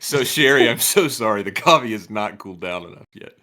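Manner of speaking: loud, mock-sorry apology. Real, not acted. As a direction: call it out, apologizing. Joking apology about hot coffee.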